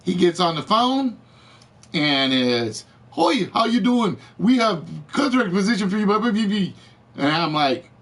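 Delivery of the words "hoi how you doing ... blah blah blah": higher-pitched imitation of a headhunter's pitch; mock recruiter rattling off an opener; rapid sales patter. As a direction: reel off a recruiter's spiel into babble. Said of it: "Slow down and say that again".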